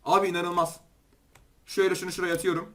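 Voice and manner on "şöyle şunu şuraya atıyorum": normal talking voice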